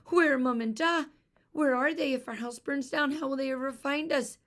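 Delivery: high, loud panicked sobbing; a little girl sobbing for her parents. Acted. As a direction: cry it out in fear for her parents. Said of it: "Eilish sobbed".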